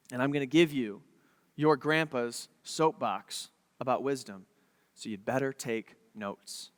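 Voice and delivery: slow down and sink into a quiet, weighty appeal; hushed fatherly sincerity; earnest father. Retold or imitated. Father's earnest plea to his sons.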